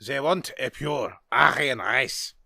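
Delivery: put-on slow German accent with 'ze' for 'they'; retry the line in a thicker German accent. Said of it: "That was a bad accent".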